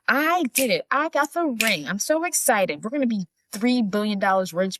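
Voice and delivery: high mock-gleeful announcement; excited. Mother phones Paige about the ring, imitated excitement. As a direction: imitate bursting with good news. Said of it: "So she's so excited".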